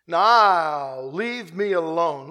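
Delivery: very slow, deliberate voicing of God; slow stern command. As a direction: stretch each word with weight. God's words, drawn out.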